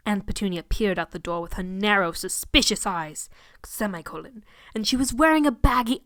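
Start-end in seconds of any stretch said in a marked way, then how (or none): none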